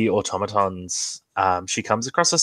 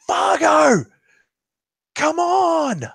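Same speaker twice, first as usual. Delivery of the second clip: high, loud yell across the beach; urgent call with a high pitch; 3 dB louder, much slower than usual. Acted. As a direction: shout at Fargo to hurry. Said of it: "yelling, she's like".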